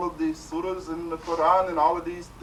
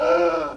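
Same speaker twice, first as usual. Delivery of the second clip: gasping for air; 3 semitones higher, 9 dB louder than usual. Retold or imitated. Held under water.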